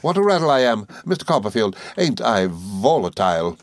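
high, quick, chattering little woman; Miss Mowcher voice. Acted. Mowcher chattering to the boys, high and merry. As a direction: rattle on like a gossiping dwarf.

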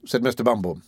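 ordinary delivery, nothing marked

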